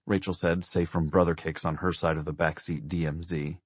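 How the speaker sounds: ordinary delivery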